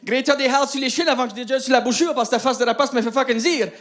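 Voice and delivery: ordinary talk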